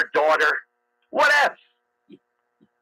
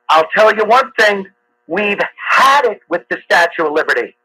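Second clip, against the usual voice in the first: normal talking voice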